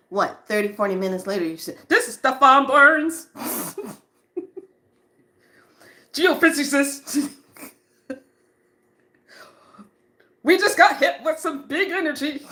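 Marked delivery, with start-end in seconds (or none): 1.9-12.4 high, loud, thrilled announcement with laughs; Stefan Burns excited. Retold or imitated. Imitating a geophysicist announcing an energy hit, excited. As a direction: burst out with breathless excitement. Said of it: "He gets so excited".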